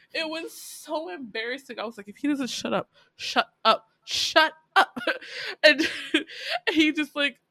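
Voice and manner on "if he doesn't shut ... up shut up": building to a loud, high "shut up"; each shut up louder than the last; mock exasperation. Host fed up with an embarrassing character, mock exasperated, building.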